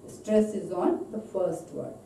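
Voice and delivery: normal talking voice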